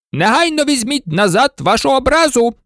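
high, outraged colonel's exclamation; indignant cry. A narrator's reading. Colonel demanding the insult be withdrawn, high indignant cry. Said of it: "вигукнув полковник стрільців".

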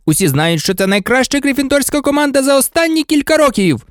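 high-pitched stadium commentary; excited match commentator. Acted. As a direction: call the team line-up like a sportscaster.

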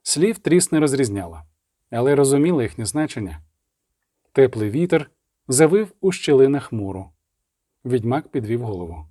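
usual speaking voice

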